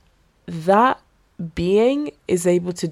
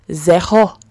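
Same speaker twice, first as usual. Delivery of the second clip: French accent; 7 dB louder than usual. Shown as a demonstration. Podcaster jokily attempting French after "nothing, zilch", French accent. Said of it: "That was my French attempt".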